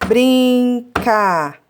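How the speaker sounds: "Brincadeiras" broken into brin, ca, dei, ras; four drawn-out beats on "Brincadeiras"; syllable by syllable. Shown as a demonstration. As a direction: slowly sound out each syllable of Brincadeiras. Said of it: "Dá pra fazer através dos sons".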